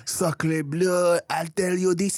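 high, slow hammed-up French voice; mock French. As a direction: croon it like a sleazy Frenchman. Playing the French skunk.

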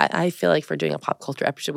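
ordinary talk

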